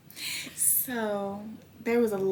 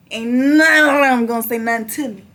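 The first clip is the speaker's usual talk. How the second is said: laughing defiance; 3 semitones higher, 13 dB louder than usual. Real, not acted. Defiant after shout.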